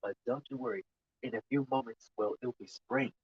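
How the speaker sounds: ordinary talk, nothing marked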